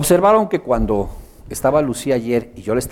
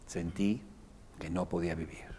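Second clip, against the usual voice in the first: much softer and lower, gentle echo; quiet comforting echo; soft accompanying tone; 6 semitones lower, 18 dB quieter than usual. Shown as a demonstration. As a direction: repeat it back tenderly to help. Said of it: "mi tono está ayudando".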